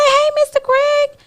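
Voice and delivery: high, slow greeting; high surprised greeting. Retold or imitated. Greeting the friend's husband on her phone, high and slow.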